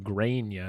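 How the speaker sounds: Grania said as GRAYN-ya; mispronounced name. Shown as a demonstration. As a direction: say Grania with a long "ay" in the first syllable. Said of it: "now it's, like".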